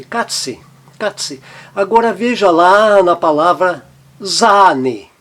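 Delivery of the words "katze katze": Katze, short A, quickly; short A demo. Shown as a demonstration. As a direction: keep the A in Katze brief. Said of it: "ele tem um som breve". (A curto em Katze)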